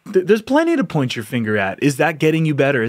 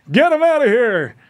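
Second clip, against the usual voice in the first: mock mob shout; 9 semitones higher, 4 dB louder than usual. Repeated mock crowd shout.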